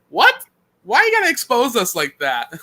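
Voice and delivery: high-pitched mock outrage; retold indignant reaction; mock indignation. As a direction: protest in exaggerated disbelief.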